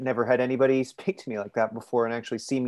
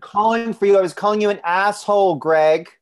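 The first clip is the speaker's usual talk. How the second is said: loud, fast, biting shout at her husband; angry yell; 8 semitones higher, 8 dB louder than usual. Acted. Wife yelling at husband she meant the insult, loud angry shout. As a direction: snap the insult back hard and loud.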